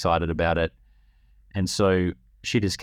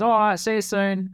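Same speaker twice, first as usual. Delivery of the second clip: little son; 14 semitones higher than usual. Retold or imitated. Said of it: "And he goes".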